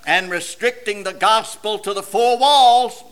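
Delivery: ordinary talk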